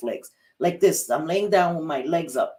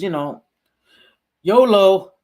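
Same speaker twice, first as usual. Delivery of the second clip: carefree whoop; 3 semitones higher, 9 dB louder than usual. Retold or imitated. Younger self brushing off doctors' warning, carefree loud whoop. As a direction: holler it out with reckless abandon.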